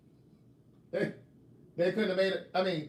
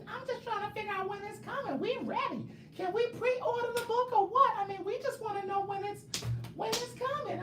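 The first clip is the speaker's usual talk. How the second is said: very high squeaky whining fan voice; mocking viewers' voices. Mocking fans asking about the fourth book, squeaky high whine. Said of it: "this is how I imagine you sounding".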